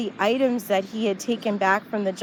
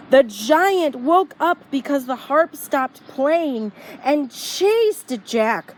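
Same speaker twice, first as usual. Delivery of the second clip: usual speaking voice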